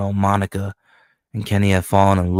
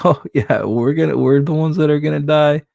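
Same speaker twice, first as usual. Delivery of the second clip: higher, put-on henchman laughing it off; a cocky goon's voice, pitched up; mocking henchman voice; 7 semitones higher, 3 dB louder than usual. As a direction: play a smug henchman who doesn't get it.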